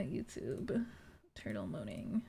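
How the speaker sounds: soft unvoiced whisper. Real, not acted. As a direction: whisper it to yourself. Whispering while typing.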